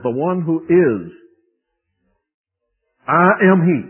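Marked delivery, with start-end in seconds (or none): 3.1-3.8 ringing proclamation. Acted. Ritual declaration.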